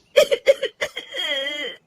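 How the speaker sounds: choked half-laugh half-sob; a sob that starts like a laugh; breaking into sobs. Acted. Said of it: "Rajni रोने लगती है।".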